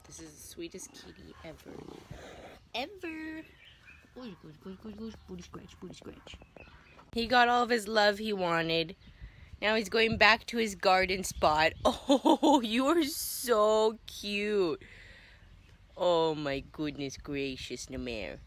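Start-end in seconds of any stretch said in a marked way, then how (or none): 0.1-6.4 tender murmur to a pet. Real, not acted. Softly petting the cat. 11.9-14.8 tender coo. Real, not acted. Cooing at the cat.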